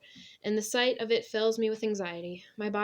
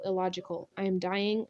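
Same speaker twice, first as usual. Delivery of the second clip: ordinary delivery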